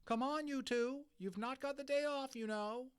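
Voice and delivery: high schoolmistress voice; teacher calling. A narrator's reading. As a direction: call them in from the door. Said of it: "teacher calling them from the door".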